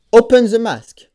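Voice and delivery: loud, deliberate command to a machine. Real, not acted. Ordering the helmet mask open, loud and deliberate. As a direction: bark it clearly at the helmet.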